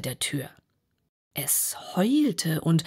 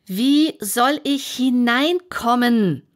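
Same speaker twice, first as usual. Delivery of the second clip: louder and slower demand; Alice asks a third time, louder and slower; even louder; 6 semitones higher, 6 dB louder, slower than usual. Acted. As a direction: stretch it out and push the volume. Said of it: "noch lauter als zuvor".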